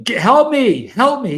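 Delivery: high, loud mock cries for help; imitated scream. Cramping man begs his friend for help, mock screaming. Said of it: "so he's screaming".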